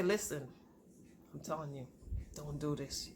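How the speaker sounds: very soft, low motherly warning; grave warning, imitated. Mom warning her daughter, quietly grave.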